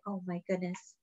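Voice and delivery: plain reading voice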